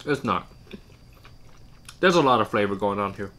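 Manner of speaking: talking between bites; chewing while talking. Real, not acted. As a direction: mouth partly full of food. Seen 2: Man bit duck leg at -5; cheeks full, jaw working while talking.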